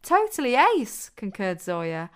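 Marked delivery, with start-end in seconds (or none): 0.0-1.1 high, loud cheer of approval; gleeful, high-pitched endorsement; enthusiastic agreement. A narrator's reading. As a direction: chime in excitedly, pitch jumping up. Friend eagerly agrees with praise.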